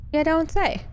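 sarcastic jab at the game. Real, not acted. Sarcastic reply repeated.

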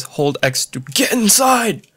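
slow, high, hammed-up reading of a game prompt; mock game prompt. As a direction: drag out "Hold X to get inside!" like an overexcited announcer. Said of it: "I love how it says".

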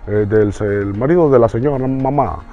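lightly put-on grown-up dad voice; big dad voice. Shown as a demonstration. Child playing house, pretending to be papa, lightly voiced. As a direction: play the pretend papa, a bit pompous. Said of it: "tú hacías una voz más grande".

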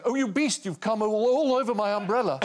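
high, camp outraged squeal; high-pitched shocked heckler; mock heckler. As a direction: shriek it like a scandalised old dear. Heckler voice in Gielgud story.

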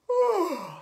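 loud, high falling "ohh"; exaggerated sleepy exhale; mock sleepy sigh. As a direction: let out a big storybook yawn-sigh. Acts the farmer fast asleep.

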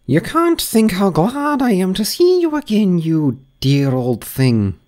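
high, slow grand duchess voice; imperious plummy grande dame. Acted. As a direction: gush like a grand old duchess.

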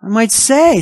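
ordinary delivery

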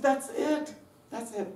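high, delighted exclamation; excited approval. Retold or imitated. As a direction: exclaim it like a teacher at a breakthrough. Retold delighted reaction to bishop.

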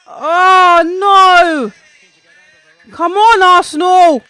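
high, loud pleading for Arsenal; anguished fan yell; desperate cheering. Real, not acted. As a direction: roar the team forward. Urging his team on.

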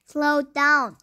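loud call to slow down; guard yelling. A narrator's reading. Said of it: "a museum guard yelled".

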